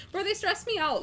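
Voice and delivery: vent, fed up; exasperated. Real, not acted. Exasperated rant about TV.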